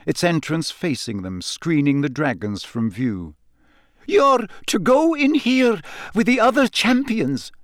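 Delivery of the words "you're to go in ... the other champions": high, rather shaky instructions; shaky McGonagall. A narrator's reading. Professor sending Harry into the tent, shaky. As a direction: give orders with a high, shaky voice. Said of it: "in a rather shaky sort of voice".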